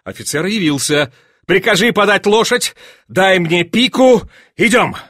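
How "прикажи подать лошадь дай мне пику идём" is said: high, emphatic orders; raised, impatient command; excited commanding. A narrator's reading. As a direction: order your aide about eagerly. Duke orders his adjutant, excited.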